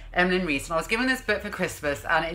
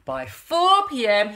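ordinary delivery

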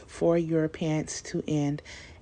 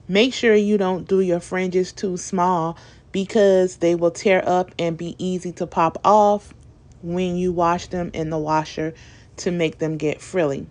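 ordinary talk

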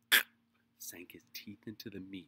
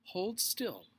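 raised, loud bossy order; firm directing; 12 semitones higher, 8 dB louder than usual. A narrator's reading. Beezus struggling with her little sister, firm loud order. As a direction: order a squirming child, loudly. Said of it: "directed Beezus".

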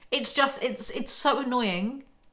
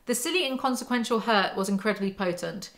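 ordinary talk, nothing marked

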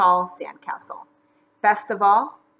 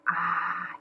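soft, lowered "ah"; contented sigh; 3 semitones lower, 7 dB quieter than usual. A narrator's reading. McGreely flopping onto his blanket, soft sigh. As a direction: sigh it out softly. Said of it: "he sighed".

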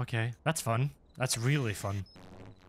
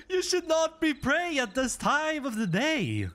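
high-pitched, panicky telling-off; high, flustered reprimand; mock scolding; 10 semitones higher than usual. Scolding a praying ghost after scare.

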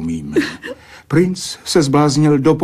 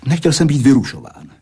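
ordinary talk, nothing marked

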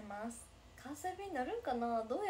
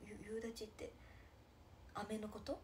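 whisper; 3 semitones lower, 8 dB quieter than usual. Real, not acted. Confused by a word.

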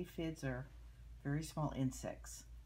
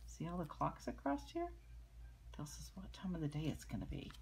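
ordinary delivery, nothing marked

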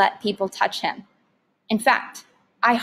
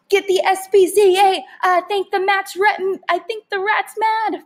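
high, loud panicked yell; 10 semitones higher, 5 dB louder than usual. A narrator's reading. Father yelling as he slams the door. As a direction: shout it, tripping over words. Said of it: "Father yelled".

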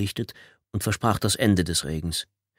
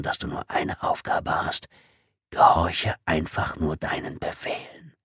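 whispered final warning; 4 semitones lower, 3 dB quieter than usual. Acted. Departing intruder warns Tommy to stay silent, whispered. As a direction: lay out the rules in a cold hush. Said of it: "zischte die Stimme".

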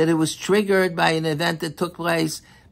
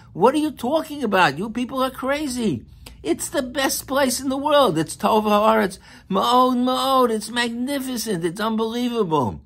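much higher, impassioned preaching; fervent rebuke; 8 semitones higher than usual. Acted. Preacher scolding the spies, impassioned and high. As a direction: rebuke the doubters with passion.

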